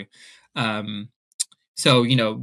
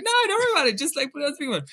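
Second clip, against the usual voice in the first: much higher, fluttery reassurance; high mock-casual voice hiding news; giddy squeak; 14 semitones higher, much faster than usual. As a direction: squeak it like you're about to burst. Reassuring mom, excited.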